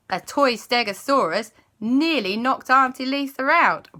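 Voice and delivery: usual speaking voice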